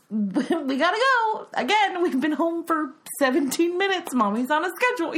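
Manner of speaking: high, loud, rushed mom voice; impatient parent hurrying her kid; hurried mom. Retold or imitated. Mom rushing her daughter out the door, clipped and high.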